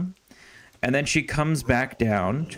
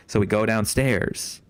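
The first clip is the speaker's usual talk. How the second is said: usual speaking voice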